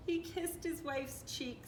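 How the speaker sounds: usual speaking voice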